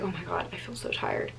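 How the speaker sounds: hushed, whispered complaint of tiredness; barely voiced, retold exhaustion; retold tired whisper. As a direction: imitate murmuring wearily to yourself. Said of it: "I was like".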